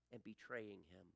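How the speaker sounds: usual speaking voice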